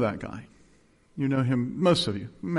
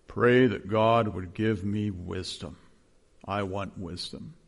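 slow, low, earnest imitation of Nace; 4 semitones lower, slower than usual. Pastor imitating Nace asking for prayer, slow and low. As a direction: plead for wisdom the way Nace did. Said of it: "in that Nace voice".

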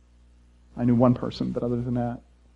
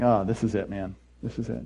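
quiet dread; 5 semitones lower, 8 dB quieter than usual. Retold or imitated. Retold dread.

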